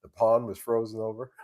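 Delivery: ordinary talk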